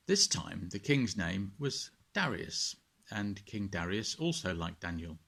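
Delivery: put-on gentle storyteller reading to children; bedtime story narration. A narrator's reading. Vicar reading a Bible story to children at bedtime, gentle narration. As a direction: read it like a bedtime tale, calm and clear.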